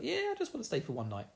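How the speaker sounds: high, quick mock voice; mock Moraine reply.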